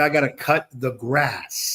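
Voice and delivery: slow, deliberate enunciation; drawn-out careful repeat for a listener who misheard; clarifying repeat. Real, not acted. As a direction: spell it out word by word.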